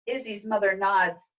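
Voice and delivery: ordinary delivery, nothing marked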